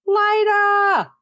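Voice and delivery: high mock shout of a name; a hero crying out to a woman he loves, mock shout; calling out. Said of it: "he's like".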